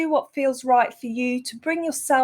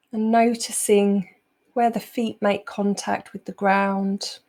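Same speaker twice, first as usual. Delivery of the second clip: unhurried meditation voice, long pauses between phrases; guided meditation; 2 semitones lower than usual. Acted. Teacher easing the group out of a busy day, guided meditation. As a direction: guide them calmly into the present moment.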